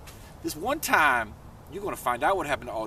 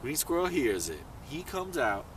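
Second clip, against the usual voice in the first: ordinary delivery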